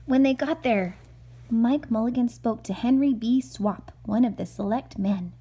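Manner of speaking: gentle storybook narration read aloud; calm read-aloud for a little boy; reading to a child. Acted. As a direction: read it like a bedtime picture book. Mother reads picture book aloud.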